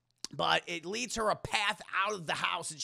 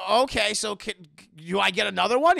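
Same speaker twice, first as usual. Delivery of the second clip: urgent; 3 semitones higher, 10 dB louder than usual. Acted. Yusuke asks urgently.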